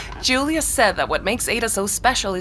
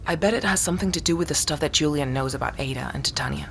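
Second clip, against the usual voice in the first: soft, secretive whisper; 5 semitones lower, 5 dB quieter than usual. Acted. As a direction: whisper it so no one overhears. Sharing a suspicion, hushed.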